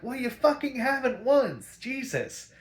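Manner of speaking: high, loud fed-up snap; mock yell of disbelief; a hammed-up annoyed outburst; exasperated retort. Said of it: "They're like".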